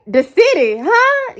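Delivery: high, loud huh; teasing puzzled huh; mock confusion. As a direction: play dumb, rising high. Joking confused reply.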